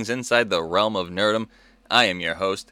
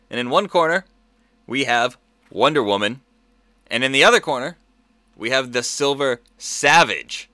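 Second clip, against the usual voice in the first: fight announcer; 3 semitones higher, 3 dB louder than usual. Acted. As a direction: ring announcer calling the fighters. Boxing-style matchup introduction.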